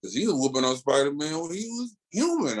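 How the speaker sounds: ordinary talk, nothing marked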